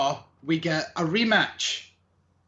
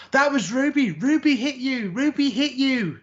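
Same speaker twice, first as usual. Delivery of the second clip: put-on high, loud screaming Australian accent; high-pitched Australian screech, imitating a wrestler; 9 semitones higher, 3 dB louder than usual. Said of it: "In my best Australian accent".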